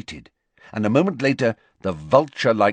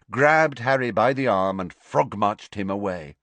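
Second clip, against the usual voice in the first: ordinary delivery, nothing marked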